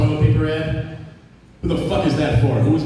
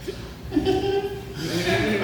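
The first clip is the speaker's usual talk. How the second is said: high giggle; 11 semitones higher, 7 dB quieter than usual. Real, not acted. Laughing at own joke.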